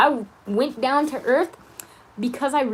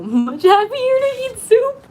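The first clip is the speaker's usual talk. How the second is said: laughing; 8 dB louder, slower than usual. Real, not acted. Laughing delight over soup.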